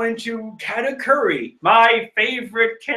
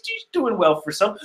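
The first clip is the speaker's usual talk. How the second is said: normal talking voice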